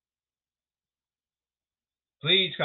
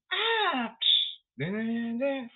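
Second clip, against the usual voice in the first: dramatic sting; 9 semitones higher, slower than usual. Acted. Mock dramatic sound effect.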